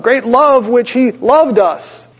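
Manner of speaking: very slow, high and weighty; slow emphatic reading. Acted. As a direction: stretch every word out. Repeating the verse for emphasis.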